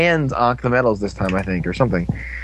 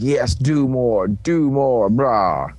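slow, drawn-out monster relish; lightly voiced gloating monster; monster urging; much slower than usual. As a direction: egg them on slowly as a creature.

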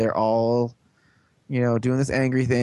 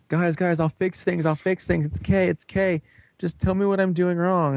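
high-pitched imitation of desperate begging; retold anxious appeasing, pitched up; mock pleading; 7 semitones higher than usual. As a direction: plead with the group in a put-on higher voice. Retells character begging the others.

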